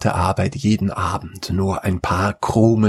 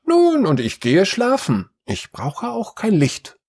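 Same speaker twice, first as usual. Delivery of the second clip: daughter's voice; 12 semitones higher than usual. A narrator's reading. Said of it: "sagte die zweite".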